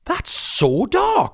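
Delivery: high, loud, drawn-out "so dark"; shocked delight. Real, not acted. Reacting to the grim prank, shocked.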